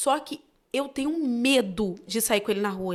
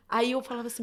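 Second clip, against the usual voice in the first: ordinary talk, nothing marked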